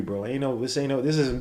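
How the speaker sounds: normal talking voice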